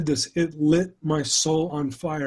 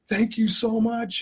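put-on higher, slower, sweet little girl voice; little girl's thanks; 7 semitones higher than usual. Girl at the lemonade stand thanking the driver, high and slow. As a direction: voice the delighted child, slow and high.

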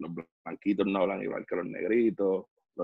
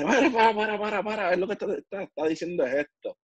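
urgent plea to stop; 10 semitones higher than usual. Retold or imitated. Coworker stopping a furious friend from a fight, high urgent pleading. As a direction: hold back a friend about to throw punches. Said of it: "un pana me dice".

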